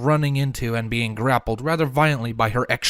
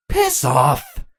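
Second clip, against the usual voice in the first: snapping; 2 semitones higher, 3 dB louder than usual. A narrator's reading. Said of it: "Chrysalis snapped".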